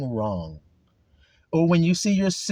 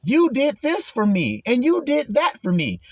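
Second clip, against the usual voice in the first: humble praise; 9 semitones higher than usual. Pretend. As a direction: give all the credit to God. Humble worshipper praising God.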